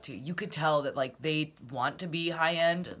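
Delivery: normal talking voice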